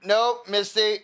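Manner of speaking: loud, high call to stop the dog; yelling at the dog. Real, not acted. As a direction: shout her name sharply to make her stop. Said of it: "I'm yelling at a dog".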